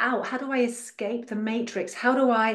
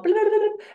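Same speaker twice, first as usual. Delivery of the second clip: mock crowd babble; 12 semitones higher, 4 dB louder, faster than usual. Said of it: "I'm making it a bit bigger and dramatizing".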